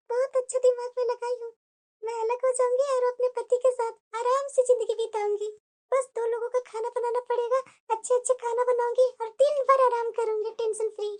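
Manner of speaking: hushed, plotting to herself; quiet scheming aside. Acted. New bride plotting to herself, hushed scheming. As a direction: murmur the plan so the family can't hear.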